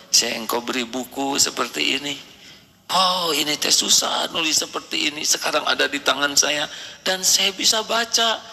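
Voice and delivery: normal talking voice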